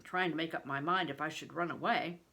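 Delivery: ordinary delivery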